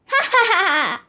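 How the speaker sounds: hammed-up ha-ha at own pun; fake laugh. Principal laughing at his own pun, hammed-up ha-ha. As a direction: chuckle at your own bad joke.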